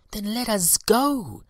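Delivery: rally the swarm to fly; bees eager. A narrator's reading. The bees decide to go to Willy, high and loud. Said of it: "said the bees".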